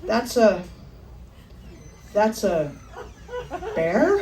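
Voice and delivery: halting, with long gaps, then rising to a question; stall, then squeak out the last word in doubt; stunned realisation. Acted. Hiker spotting fur in the trees, haltingly stunned. Slow realisation of seeing bear.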